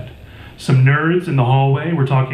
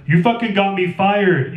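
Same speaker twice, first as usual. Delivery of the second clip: higher, slower yelled accusation; yelling; 7 semitones higher than usual. A narrator's reading. Fired teen yells at taunting kids, higher slow yell. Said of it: "I yelled at them".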